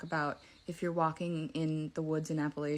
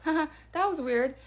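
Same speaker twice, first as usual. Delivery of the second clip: nervous laugh; 8 semitones higher, 3 dB louder, slower than usual. Real, not acted. Nervous laugh after the voice stops.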